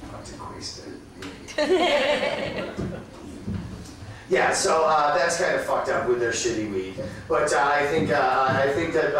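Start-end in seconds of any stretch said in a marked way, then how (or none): 0.1-3.0 Spanish line drawn out slowly, ending high through laughter; laughing Spanish pickup line. Retold or imitated. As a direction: stretch the Spanish words out slow and crack up at the end. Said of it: "That's how you get drugs or a date in Mexico".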